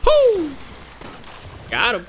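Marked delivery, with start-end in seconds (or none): 0.0-0.5 high, loud "woo"; excited whoop. Real, not acted. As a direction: let out a high cheer. Cheering during the fight.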